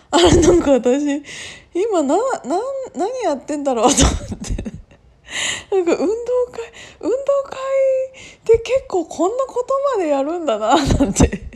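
giggling high through the words; laughing at herself, pitch way up. Real, not acted. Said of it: "頭がおかしくなっちゃった".

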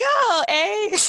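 high-pitched mimicked squeal of delight; mock delight. Americans reacting to a Canadian's eh, mock-delighted. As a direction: pitch it way up, imitating a thrilled listener.

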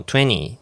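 "twenty" said as "tweny", no T burst; T dropped. Shown as a demonstration. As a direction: skip the T release in "twenty". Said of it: "もうTの破裂いらないんです". (Tの破裂なしの「twenty」)

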